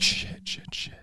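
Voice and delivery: breathless whispered panic; whispered curse. Retold or imitated. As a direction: hiss it under your breath.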